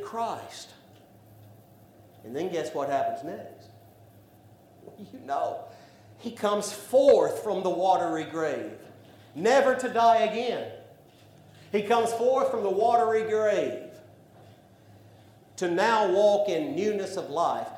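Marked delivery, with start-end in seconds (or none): 6.2-17.7 louder, building preacher cadence; preaching at full force. Acted. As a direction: rise into the proclamation. Preaching climax on resurrection.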